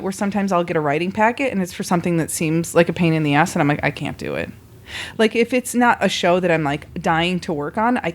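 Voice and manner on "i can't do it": low and soft, giving up; defeated mumble. Retold or imitated. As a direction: sink into a hopeless little sigh of a line. Dreading a tedious writing packet.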